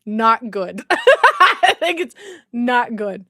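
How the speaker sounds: loud, slow, through laughter; words drawn out around laughter; laughing. Real, not acted. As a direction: crack up while admitting it. Laughing at own failed mimicry.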